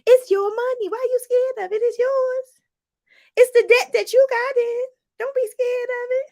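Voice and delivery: high, insistent pep talk; high, emphatic coaching; impassioned urging. Real, not acted. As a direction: fire them up about their money.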